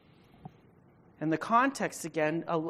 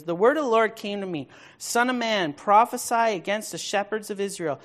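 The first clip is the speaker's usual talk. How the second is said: higher, louder prophetic reading; preaching at full force; 4 semitones higher, 7 dB louder than usual. Acted. Pastor reading God's rebuke of Israel's shepherds, booming. Reads Ezekiel.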